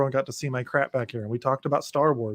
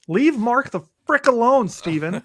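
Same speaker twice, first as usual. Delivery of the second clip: higher, louder, drawn-out mock scolding; hammed-up exasperated rant, pitched up; mock exasperation; 8 semitones higher, 5 dB louder, slower than usual. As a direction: playfully tell off someone who isn't there. Mock plea to absent character Steven.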